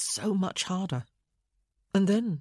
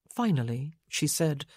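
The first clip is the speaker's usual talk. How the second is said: ordinary delivery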